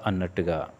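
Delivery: ordinary delivery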